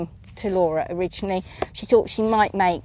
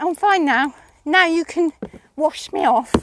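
pony voice; 6 semitones higher than usual. Retold or imitated. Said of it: "and went".

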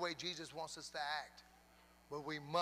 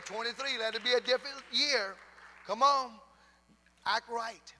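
loud, raised-pitch church exhortation; full-force preaching; 5 semitones higher, 7 dB louder than usual. Real, not acted. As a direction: drive each phrase home to rouse the congregation.

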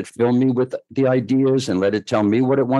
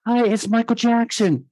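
much higher put-on voice of the caller; a shy superstar caller voiced far higher; 13 semitones higher than usual. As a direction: pitch way up to play the famous caller on the phone. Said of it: "this voice goes".

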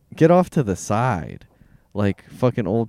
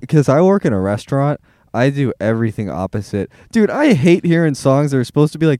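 ordinary delivery

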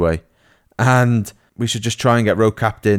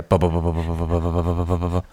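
drum roll; 4 semitones lower, 4 dB quieter, faster than usual. Pretend. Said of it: "do me a cheeky drum roll".

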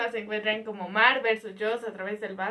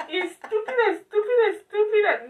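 self-scolding; 8 semitones higher than usual. Retold or imitated. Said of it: "y yo".

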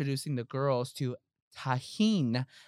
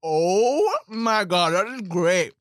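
loud, slow, amazed; impressed exclamation; 3 semitones higher, 7 dB louder, slower than usual. Real, not acted. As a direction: marvel at the sound. Reacting to crunch sound.

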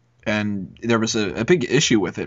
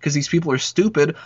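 retold rant climbing higher and higher, fast at first; 9 semitones higher than usual. Mocking teammates to a buddy at kickball, rising high rant. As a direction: build a mock tirade from high to very high. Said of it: "And I'm like".

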